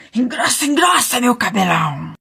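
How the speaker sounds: put-on old hag crying out her charm; witch shouting. A narrator's reading. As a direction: yell the spell at the hair. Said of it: "E a velha gritou".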